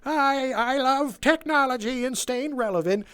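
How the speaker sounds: high, slow, hammed-up old lady. Mimicking an old lady customer in the store, high and slow. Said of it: "an old lady like".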